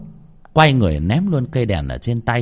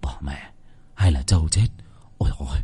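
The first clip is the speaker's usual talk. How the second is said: worried thought; 8 semitones lower, faster than usual. Acted. Husband suddenly fearing a buffalo died, muttering. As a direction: drop to a hushed, low aside. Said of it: "Chợt lão nghĩ".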